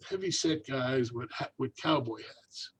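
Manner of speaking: normal talking voice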